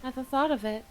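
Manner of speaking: dreamy hushed recital; soft ASMR voice. Pretend. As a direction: murmur it like an ASMR script. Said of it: "doing A S M R voice".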